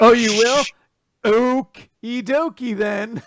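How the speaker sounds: loud, high, drawn-out cheesy reply; milk the pun slowly in a put-on voice; corny mock delight; hammed-up punning. Hamming up a tree pun riff.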